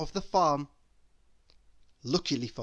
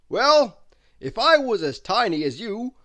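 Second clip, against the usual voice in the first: loud, hearty giant's hello; Mister Strong's voice; 10 dB louder than usual. Acted. Mister Strong greeting tiny Miss Tiny, hearty and loud. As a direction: greet her big and booming.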